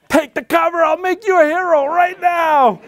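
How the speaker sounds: very high, loud talking-dog voice; pleading dog. Acted. Dog urging its handler to uncover the child, pleading. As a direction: yelp it out high, as a desperate dog. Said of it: "My poor dog is going".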